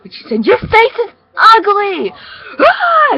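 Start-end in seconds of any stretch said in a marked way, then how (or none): 0.5-2.1 high, very loud, drawn-out yell; screamed insult. Acted. Girl hurls an insult in the child's face, screaming. 2.6-3.1 short high-pitched loud scream. Acted. Girl screams at the child and runs off, screaming.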